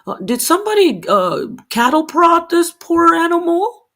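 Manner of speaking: high, lightly voiced mock pity; playing a naive sympathetic bystander; mock sympathetic onlooker. Imitating an onlooker who pities the raging animal. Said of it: "and say".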